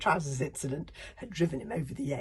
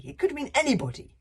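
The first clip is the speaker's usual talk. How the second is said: louder, higher, rushed; blustering chief; 10 semitones higher, 9 dB louder, faster than usual. Acted. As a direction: brush it off loudly.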